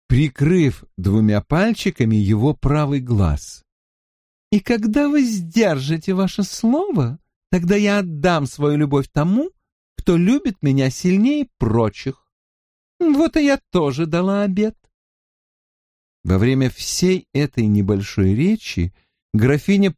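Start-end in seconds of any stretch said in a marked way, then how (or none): 4.5-14.8 high, knowing feminine vow; coquettish lady. Acted. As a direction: promise love with a hint of challenge. Countess Salisbury's vow. (кокетливо и многозначительно)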